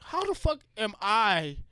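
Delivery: hearing neighbors call themselves minorities, ranting in disbelief; exasperated outburst. Retold or imitated. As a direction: throw the question up in disbelief. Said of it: "and I'm like".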